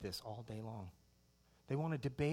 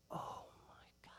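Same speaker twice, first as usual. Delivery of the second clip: breathless, voiceless wonder; awed whisper; 14 dB quieter than usual. Retold or imitated. Preacher marvelling at 3D Star Wars, whispered awe.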